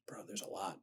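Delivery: hushed, voiceless whisper; barely audible whisper; whispered aside. Real, not acted. Aside on how many films exist.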